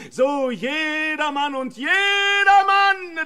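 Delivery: imitating the dreadful summons, loud and drawn out; fearful shout. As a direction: cry the name out like a voice from hell. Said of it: "in fürchterlicher Weis und laut und mächtiglich".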